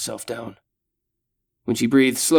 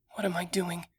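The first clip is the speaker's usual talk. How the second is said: mumbling; 7 semitones higher, 9 dB quieter than usual. Acted. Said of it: "she mumbles".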